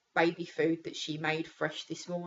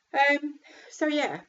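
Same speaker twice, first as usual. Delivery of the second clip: crying; 9 semitones higher, 9 dB louder, slower than usual. Real, not acted. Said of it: "I am sorry for crying".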